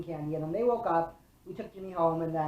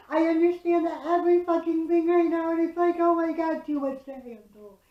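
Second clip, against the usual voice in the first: higher, louder voice on the edge of breaking; overwhelmed cry; 10 semitones higher, 6 dB louder than usual. Retold or imitated. Retelling the breakdown on Canfield, a higher, louder overwhelmed voice about to break. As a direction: let it spill out, too much to handle.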